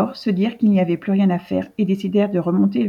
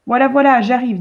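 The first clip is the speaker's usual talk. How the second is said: raised voice called down from upstairs; calling out; 4 semitones higher, 6 dB louder than usual. Acted. The vet calls down to the men knocking, raised voice. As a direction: call out to the door from a window.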